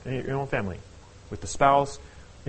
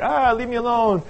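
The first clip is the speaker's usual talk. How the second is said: higher, louder, flustered brush-off; mock exasperated outburst; 8 semitones higher, 5 dB louder, slower than usual. [name] fending off his grilling friend, mock exasperation. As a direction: toss it out like a grenade and flee. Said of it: "almost like a little hand grenade".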